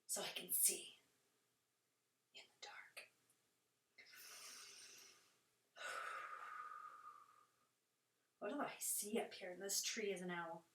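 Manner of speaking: quiet, soft night voice; hushed. Shown as a demonstration. Teacher seeing in the dark as an owl, hushed. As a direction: hush as if in the dark.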